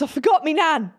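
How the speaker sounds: high, loud panicked wail; girlfriend panicking. Retold or imitated. Girlfriend realising she left her nan, high loud panic. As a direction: blurt it out in alarm, high and loud. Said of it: "She goes".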